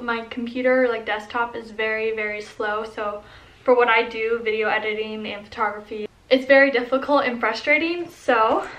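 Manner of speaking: normal talking voice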